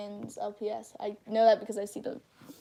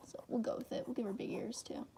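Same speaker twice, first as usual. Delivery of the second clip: softly whispered self-talk; hushed muttering under the breath; whispered to herself; 11 dB quieter than usual. Real, not acted. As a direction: whisper while concentrating on a drawing. Muttering to herself while drawing.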